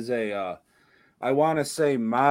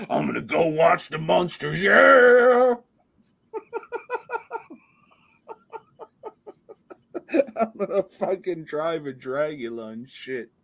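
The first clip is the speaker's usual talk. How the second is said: higher put-on rocker voice with a laugh in it; 9 semitones higher, 3 dB louder than usual. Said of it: "What other wholesome [name] things".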